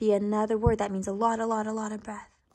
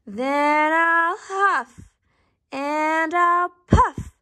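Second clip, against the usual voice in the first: very slow, drawn-out, higher wolf threat; slow storybook bellow, pitched up; shouting wolf; 6 semitones higher, much slower than usual. A narrator's reading. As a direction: draw out every word as the big wolf. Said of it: "shouted the wolf".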